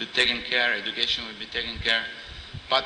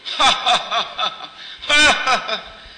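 loud, high acted belly laugh; big laughter; 10 semitones higher, 5 dB louder than usual. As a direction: burst out in a big booming mock laugh from the earth. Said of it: "coming a big laughter".

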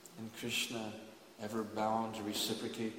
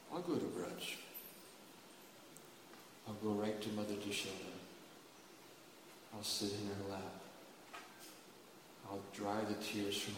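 gentle reassurance; 3 semitones lower, 10 dB quieter than usual. Acted. Subhadra calmly consoles everyone.

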